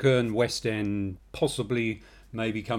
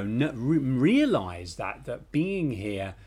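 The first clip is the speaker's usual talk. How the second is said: ordinary talk, nothing marked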